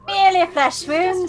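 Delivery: high, loud put-on British voice; hammed-up British comic quote; mock British accent. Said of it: "whenever you do your accent".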